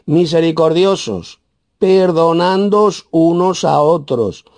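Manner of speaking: high, slow verse reading; emphatic scripture reading. Real, not acted. Preacher reads the verse on forgiving, high and slow. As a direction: read it out high and measured.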